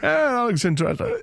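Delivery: high mock Sean Connery. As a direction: ham up a Connery line. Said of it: "Everything I do ends up being Sean Connery".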